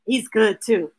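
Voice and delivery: ordinary delivery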